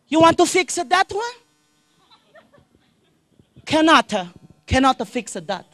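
high, loud mock Chinese shop lady; Chinese-accented lady telling a customer she can't fix the battery. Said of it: "owned by a Chinese lady".

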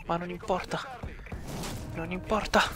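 soft, half-whispered; hushed. Real, not acted. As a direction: keep it down late at night. Still keeping down after night screaming.

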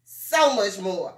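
usual speaking voice

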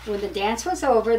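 plain reading voice

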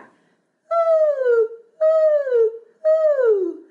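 loud, very high owl-like "ooh"; high owl hoot. Shown as a demonstration. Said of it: "high voice that sounds like an owl".

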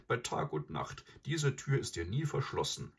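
ordinary delivery, nothing marked

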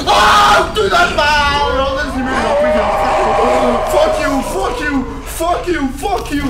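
cornered by monsters, panicked loud yelling. Real, not acted. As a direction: yell it away in fear. Screaming at monsters.

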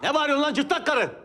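angry. Acted. Heated auction quarrel.

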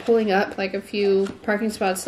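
ordinary delivery

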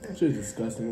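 ordinary talk, nothing marked

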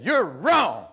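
loud, angry snap; angry shout. Retold or imitated. Husband shouting at his wife in the kitchen, angry. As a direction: bark it at your wife.

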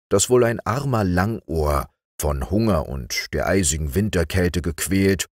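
ordinary delivery, nothing marked

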